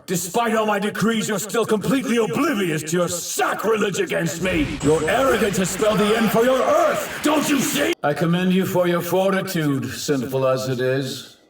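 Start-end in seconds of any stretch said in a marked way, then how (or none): 0.1-7.9 high tirade, building to a shout; indignant rage. Acted. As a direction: rail at their sacrilege, climbing higher. Villain rages at blasphemers.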